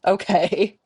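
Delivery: laughing through the word. Real, not acted. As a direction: laugh it out, rising. Laughing at own allergy story.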